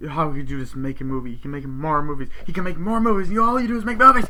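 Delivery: mounting mock outrage; acted angry rant. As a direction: imitate an accusation escalating with each repeat. Acted family quarrel, building rant.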